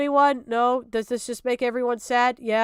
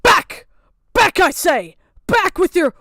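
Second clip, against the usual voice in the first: loud, high mock banishing; mock exorcist; 5 semitones higher, 8 dB louder than usual. Warding off a creepy drawing, hammed-up exorcist shout. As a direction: ward it off like a hammy priest.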